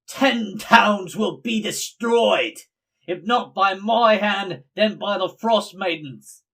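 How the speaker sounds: loud, high, drawn-out proclamation; shouting. Acted. Game master as woman atop the sarcophagus, shouting. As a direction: shout it out like a prophecy of doom. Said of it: "and then she shouts".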